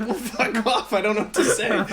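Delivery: high, loud exasperation through laughter; acted-out fed-up outburst, half laughing; mock exasperation. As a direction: throw up a laughing, helpless retort. Acts out exasperated reply, laughing.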